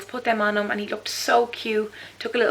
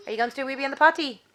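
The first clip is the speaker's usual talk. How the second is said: quick sing-song coaxing; 6 semitones higher, 6 dB louder than usual. Real, not acted. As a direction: hurry him along cheerfully. Repeated coaxing of toddler.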